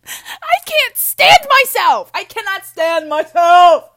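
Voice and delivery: high, full-volume shriek; ear-splitting frustrated outburst; blaring scream. Real, not acted. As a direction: scream it in wild exasperation. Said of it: "this is blaring your ears".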